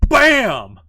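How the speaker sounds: very loud, high, stretched exclamation; booming imitated impact; explosive slap effect. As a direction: burst it out like a smack landing. Imitates the sudden slap landing.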